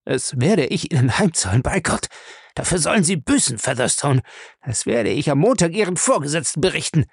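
high-pitched, indignant character voice; outraged protest from the man on the floor; beaten man's angry threats. Acted.

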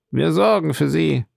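high, mildly trembling assurance. A narrator's reading. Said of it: "mit zitternder Stimme".

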